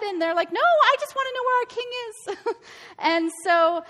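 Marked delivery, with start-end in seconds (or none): 0.5-2.6 mock whiny Israelites. Israel mocked, demanding a king.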